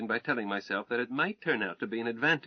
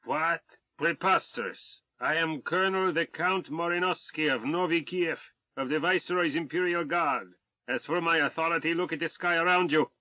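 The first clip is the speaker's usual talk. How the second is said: higher, louder furious officer's tirade; a blustering Tsarist officer shouting insults; arrogant Russian colonel; 6 semitones higher, 3 dB louder than usual. Acted. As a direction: rage at the British swine with haughty contempt. Colonel indignant.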